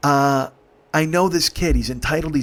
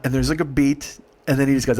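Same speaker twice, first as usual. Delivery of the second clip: ordinary talk, nothing marked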